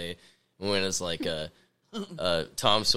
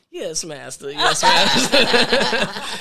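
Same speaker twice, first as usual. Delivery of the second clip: building to very slow, higher, louder, laughing; mock servile; 6 semitones higher, 5 dB louder, much slower than usual. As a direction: drag out the second master. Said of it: "and I'm like".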